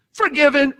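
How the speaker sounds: high ringing shout; triumphant. Real, not acted. Preacher proclaiming her release, high and ringing. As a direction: declare it in triumph.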